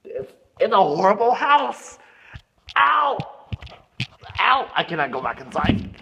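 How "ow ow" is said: sharp playful cries of pain; pained yelps. Pretend. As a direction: yelp as if hurt. Doll bumps into chimney.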